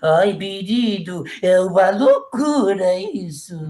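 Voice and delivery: cabeleireiro afetado, palavras bem esticadas; imitação arrastada e afetada, lenta e teatral; Maires. Retold or imitated. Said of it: "ele falava assim". (voz afetada, cantada e lenta)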